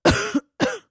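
loud, high choking cough; exaggerated smog cough; hacking cough. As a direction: cough like the air is poison. Choking on polluted air in bit.

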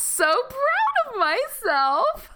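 very high, slow gushing into a whimper; high-pitched mock weepy lament; proud turning mock-tearful. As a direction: swell with pride, then crumble playfully. Proud, then mourns favourite troll.

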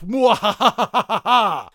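slow hammed-up evil laugh; evil villain cackle.

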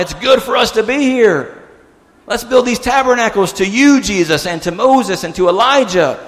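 high, exuberant exclamation; elated Peter. Retold or imitated. Peter on the mountain offering tabernacles to Jesus, elated.

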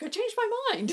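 very high sheepish little voice; squeaky chickening out. Retold or imitated. Bruce quoted by a podcast host, sheepish high squeak. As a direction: squeak it out like a coward backing down. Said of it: "and he's like".

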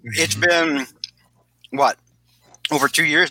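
talking while chewing pistachios; mouth full. Real, not acted. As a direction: mouth full of nuts, words round a bite. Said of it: "I'm eating pistachio".

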